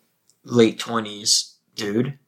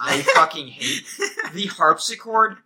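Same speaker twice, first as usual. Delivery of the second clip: laughing rant; 6 semitones higher, 3 dB louder than usual. Real, not acted. Ranting about harpsichord.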